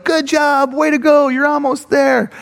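high-pitched roadside cheer; mock peppy crowd voice; cheering spectators. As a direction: call encouragement to passing runners. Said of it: "people are saying, like".

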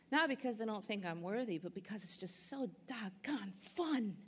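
ordinary talk

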